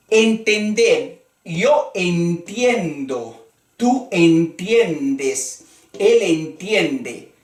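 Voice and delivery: "entiendo" dicho despacio, cada sílaba clara; conjugación lenta. Shown as a demonstration. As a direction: dilo despacio para que repitan. (conjugación pausada de profesor)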